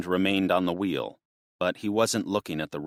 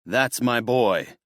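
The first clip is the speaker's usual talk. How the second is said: burst out; 5 semitones higher, 4 dB louder, slower than usual. A narrator's reading. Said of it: "Buck burst out".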